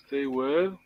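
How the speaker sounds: ordinary talk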